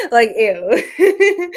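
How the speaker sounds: disgusted laugh at herself. Real, not acted. Singer laughing at her own bad take. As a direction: cringe through a laugh.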